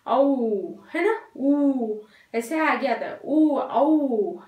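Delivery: औ and ऊ said slowly with rounded lips; teacher shows the lip movement for औ; showing lip rounding. Shown as a demonstration. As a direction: push the lips forward on ऊ and औ. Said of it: "हमारे lip movement कैसे होते हैं".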